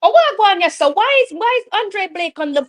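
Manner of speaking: high, loud shocked outburst; sudden alarmed commentary; shocked outcry. Real, not acted. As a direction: cry out in disbelief as players drop. Shocked at players going down.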